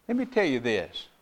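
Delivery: usual speaking voice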